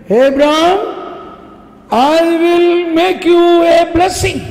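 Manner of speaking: high, loud, booming divine call; voice of God. Retold or imitated. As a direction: announce it as God himself calling. God's voice speaking to Abraham.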